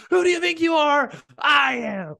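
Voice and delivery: high, loud, cracking victory scream; stream subscribe voice. Retold or imitated. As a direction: belt it like a crazed champion. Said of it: "that's the voice thing it goes".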